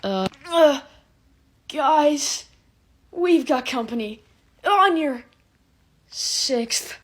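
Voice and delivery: tense, urgent squad-leader warning; mock soldier alert. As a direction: warn like a soldier under fire. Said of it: "this is me if".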